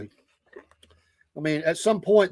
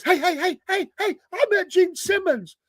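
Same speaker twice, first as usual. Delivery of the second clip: high-pitched drunken bragging, imitating a friend; hammed-up tipsy excitement, pitched way up; excited drunk friend; 13 semitones higher than usual. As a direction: mimic an excited drunk calling for attention. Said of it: "drank the whole time".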